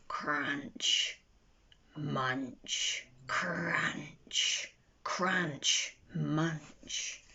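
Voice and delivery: hushed, low crunch and munch; a low, hushed munching chant; munching sounds. A narrator's reading. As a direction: breathe out each crunch softly like tiny jaws chewing. Strange munching sound in dandelions.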